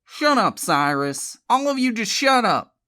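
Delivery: high, loud outburst; angry order. Acted. Charlie silences Cyrus and everyone, angry and loud. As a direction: lash out to silence the room.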